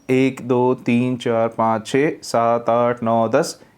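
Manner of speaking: counted out one word at a time; jerky word-by-word. Shown as a demonstration. Teacher shows the student's jerky reading by counting out numbers one at a time. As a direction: read like counting, each word separate. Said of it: "इस तरह से आप पढ़ रहे हैं".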